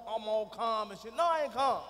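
ordinary talk

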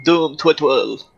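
slow, drawn-out Pokemon creature call; Bulbasaur. Acted. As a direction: say it like a cartoon monster's cry. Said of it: "I say Doom Turtle like that".

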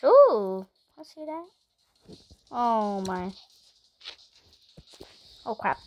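ordinary delivery

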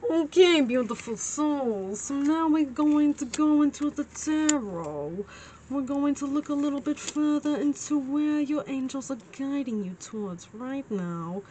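put-on playful New Jersey accent. Tarot reader guiding viewers into the tarot, New Jersey accent. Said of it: "I don't know why I'm doing this accent".

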